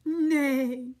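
loud, drawn-out neighs; horse neighing. Acted. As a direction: call out like a hungry horse. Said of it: "he calls to the farmer".

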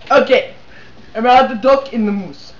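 usual speaking voice

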